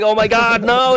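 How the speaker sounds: high-pitched retold cry; mock panicked plea. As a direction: plead in comic alarm. Retold laughing plea during attack.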